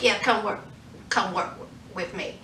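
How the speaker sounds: a hint of a sinister whisper-like pitch; creepy low invitation. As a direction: draw her in like a menacing recruiter. Killer's menacing invitation.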